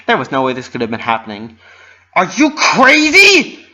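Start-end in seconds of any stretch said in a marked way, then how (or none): 2.2-3.7 very high, loud, slow scream. A narrator's reading. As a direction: scream at the pony who tackled her. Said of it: "Roseluck screamed".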